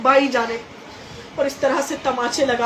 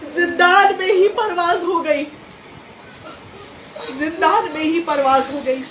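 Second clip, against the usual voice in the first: impassioned lament preaching; 4 semitones higher than usual. Acted. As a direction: cry the tragedy out to the mourners, the voice climbing high. Majlis narration at full force. (ऊँची और तेज़ आवाज़ में दर्द भरा मजलिसी बयान)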